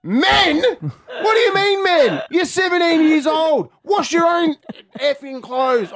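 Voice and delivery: very high, loud retold rant; going nuts. Dad tells seventeen-year-old to wash her clothes, retold furious shouting. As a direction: blow your top, drawing the words out. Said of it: "Oh, mate, I went nuts".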